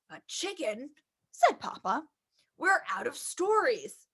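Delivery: low, soft father voice; a gentle low dad tucking in his child; reading the father's lines low and soft; papa voice. Acted.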